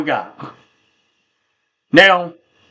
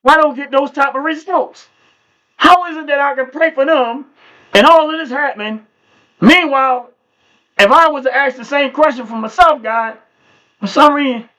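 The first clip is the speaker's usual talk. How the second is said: higher, urgent plea to God; pleading question to God; 7 semitones higher than usual. Retold or imitated. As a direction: press God for an answer, voice up high. Asking God why not for himself.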